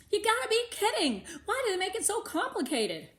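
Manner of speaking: high, loud disbelief; exasperated. Acted. Melody fed up with insurance paperwork, exasperated. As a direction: throw up your hands at the forms.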